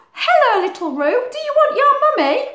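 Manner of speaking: very high, loud and fast; character greeting. Acted. Australian animals welcoming little Roo, high and fast. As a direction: greet the joey sweetly.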